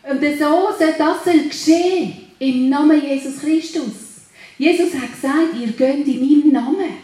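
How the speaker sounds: loud, driving sermon; loud revival preaching; fiery preaching. Acted. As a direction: preach it with full force to the congregation. Preaches at full force.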